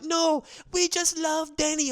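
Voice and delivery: high mock-adoring fan voice. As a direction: gush like a starstruck fan.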